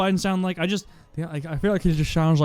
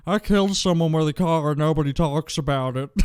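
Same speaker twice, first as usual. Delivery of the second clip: 1 mock teeth-in voice. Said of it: "Is that what she sounds like?".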